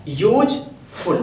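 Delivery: Useful split as "यूज - फुल"; broken into syllables. Shown as a demonstration. Teacher showing how the word breaks, useful broken into syllables. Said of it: "दो बार में word".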